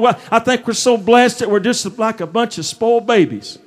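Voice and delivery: ordinary talk, nothing marked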